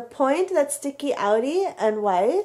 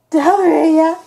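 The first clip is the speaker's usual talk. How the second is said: calling out; 4 semitones higher, 9 dB louder than usual. Real, not acted. Calling the pet by name.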